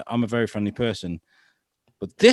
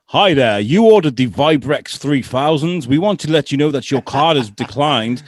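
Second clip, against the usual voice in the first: prank call voice; 3 semitones higher, 6 dB louder than usual. Retold or imitated.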